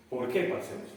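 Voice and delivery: ordinary talk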